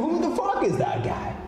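ordinary talk

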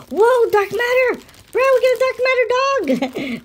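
high-pitched thrilled outburst; excited amazement. Real, not acted. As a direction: squeal it out in real amazement. Surprise find while unboxing toys.

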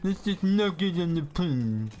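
mildly mocking dopey teammate voice; a hint of a dumb teammate. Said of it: "they're just like".

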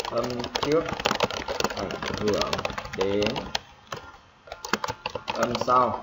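long pauses between phrases, as if dictating; spaced-out dictation of a rule; piece by piece. Shown as a demonstration. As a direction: dictate it slowly in small chunks. Teacher repeats rule piece by piece.

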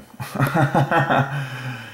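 a light, high delighted laugh. Real, not acted. Pleased with the word pattern, high light laugh.

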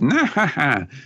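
imitated high cartoon chuckle; Luffy's laugh. Podcaster doing the hero's laugh, imitated chuckle. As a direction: put on a short, high character laugh. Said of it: "he's doing his laugh".

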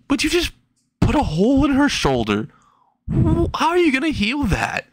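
very high, incredulous objection; squeaky, high-pitched dismay; aghast protest. Acted. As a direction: argue in comic disbelief. Aghast argument about the wound.

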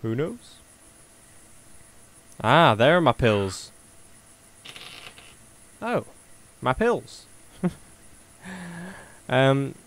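ordinary talk

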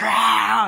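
short, high, loud cry "Ah!"; mock scream. Reviewer acts out the mother's scream in the struggle. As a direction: let out a startled fight yelp.

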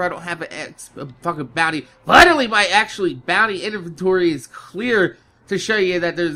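ordinary delivery

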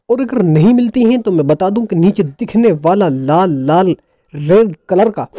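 ordinary talk, nothing marked

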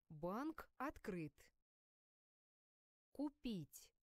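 usual speaking voice

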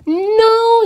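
a high loud sharp no; firm no. Acted. Grandma refusing the child, a high loud no. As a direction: shut the child down.

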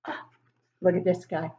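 normal talking voice

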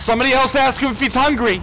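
high, loud protest; loud high plea to be left alone; exasperated shout. Real, not acted. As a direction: yell it out, pitched well up. Pleading with enemy mid-fight.

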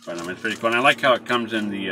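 speaking with pipe in mouth; talking around pipe. Real, not acted. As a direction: pipe clenched in teeth. Seen 5: Pipe stem clenched between lips throughout labelled words; gone by 2.2.

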